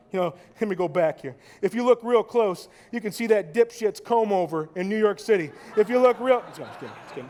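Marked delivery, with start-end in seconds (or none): 1.6-6.4 louder, jabbing at the picture; mock outrage jab. Mock pointing out Trump. 6.5-7.3 much softer, lower, quick retreat; hushed take-back. Acted.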